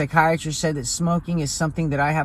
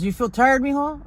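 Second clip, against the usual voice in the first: higher, gentle grandpa asking; grandfather voice; 9 semitones higher than usual. Retold or imitated. Grandfather asking his grandson how he feels, higher caring voice.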